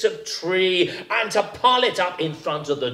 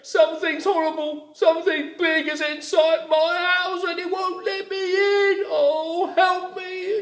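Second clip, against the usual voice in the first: high, pleading rabbit calling for help; high, scared rabbit voice; frightened rabbit; 13 semitones higher than usual. A narrator's reading. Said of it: "said Rabbit".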